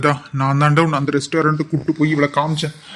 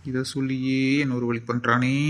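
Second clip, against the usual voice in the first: muttered aside; 3 semitones lower, 7 dB quieter than usual. Acted. Doctor grumbles to himself.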